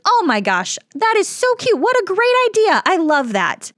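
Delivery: high, loud gushing over the doll; gushing lady. Retold or imitated. Woman on a scooter gushing over the doll, high and squealy. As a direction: squeal it like a delighted stranger. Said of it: "she goes".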